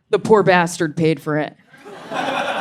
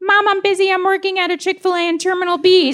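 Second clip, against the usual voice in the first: put-on much higher, quick, self-important kid voice; busy toddler; 10 semitones higher, faster than usual. Toddler to his mom, high and fast, too busy. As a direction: brush mom off like a tiny busy worker. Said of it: "Sydney was like".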